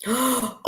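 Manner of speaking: quick, amused intake of breath; delighted gasp. Real, not acted. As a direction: gasp before bursting out. Delighted reaction to a joke.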